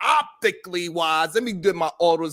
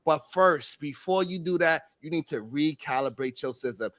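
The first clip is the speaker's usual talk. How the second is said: much softer, mock-tender encouragement; tender coaching; 6 semitones lower, 7 dB quieter than usual. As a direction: coax him gently like a devoted partner. Partner voiced in tender pep talk.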